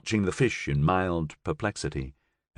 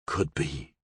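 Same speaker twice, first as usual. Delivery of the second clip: low, soft, resigned gangster reply; sighing; 6 semitones lower, 8 dB quieter than usual. A narrator's reading. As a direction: concede it with a sigh. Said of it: "he sighed".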